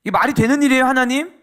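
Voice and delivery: loud, high mock protest at God; imitated complaint. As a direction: act out an indignant complaint, pitched high. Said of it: "원망해요". (하나님께 큰 소리로 원망하는 흉내)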